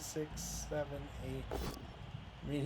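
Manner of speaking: muttering under breath. Real, not acted. Counting squares under breath.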